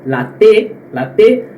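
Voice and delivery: "T" said "te" with a hard t; hard tongue-tip t in "La T", no "ch"; Northeastern-style T. Shown as a demonstration. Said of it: "o T do nordestino".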